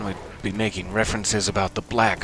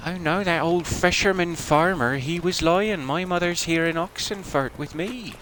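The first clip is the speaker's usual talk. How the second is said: a lightly hammed-up girl's voice, higher; mock daughter voice; 9 semitones higher than usual. Streamer mocks the daughter's game voice with a high, hammed-up girl's voice. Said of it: "that's what her daughter sounds like".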